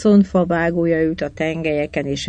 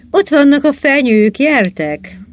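higher and louder, Ott punched hard; excited call; 8 semitones higher, 4 dB louder than usual. Acted. Calling the crew over after spotting the pines, excited. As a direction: shout it out as you spot them.

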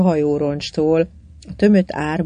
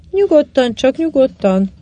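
raised, higher cautioning call; 7 semitones higher, 4 dB louder than usual. A narrator's reading. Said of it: "kiáltotta John".

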